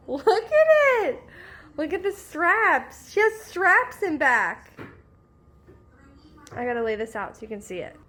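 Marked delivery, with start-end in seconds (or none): 0.1-4.8 high and loud, laughing with delight; excited. Real, not acted. Showing the viewers the tank top, high delighted gush. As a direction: gush over the gift, laughing.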